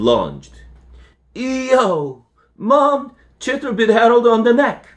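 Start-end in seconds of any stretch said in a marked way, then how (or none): 1.3-4.9 put-on high, loud child's call; kid yelling out. Toby shouts to his mom about the bite, high loud call. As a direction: yell for mom from across the house.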